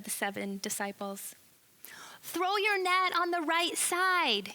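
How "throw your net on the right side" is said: slow, high and loud across the water; yelled instruction. Acted. Stranger on shore calling to the disciples, slow loud shout. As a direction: call the order out to the boat. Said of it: "he yells out at them".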